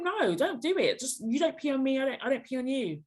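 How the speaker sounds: high-pitched, singsong telling-off; acted refusal. Guest voices what the listener should tell him, high mock-firm refusal.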